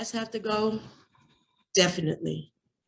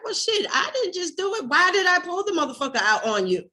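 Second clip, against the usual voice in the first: high, rising heated outburst; heated retort; 9 semitones higher, 4 dB louder than usual. Retold or imitated. To him mid-argument, defensive heated outburst. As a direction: fire back in disbelief, voice climbing. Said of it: "I was like".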